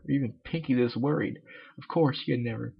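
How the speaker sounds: ordinary delivery, nothing marked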